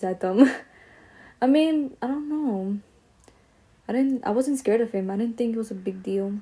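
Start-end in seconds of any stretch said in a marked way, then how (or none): none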